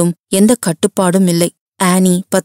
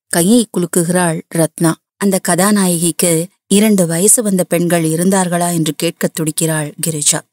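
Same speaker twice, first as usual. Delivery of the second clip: plain reading voice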